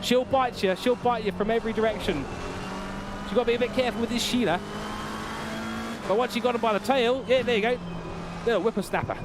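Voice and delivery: high, excited Aussie wildlife-presenter voice; Steve Irwin. Acted. Streamer warning viewers about a biting creature, Australian wildlife-host impression. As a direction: narrate a dangerous animal encounter in hammed-up, high-pitched Australian. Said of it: "probably my best Steve Irwin".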